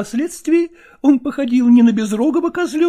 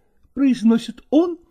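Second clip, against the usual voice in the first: ordinary delivery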